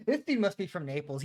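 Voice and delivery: usual speaking voice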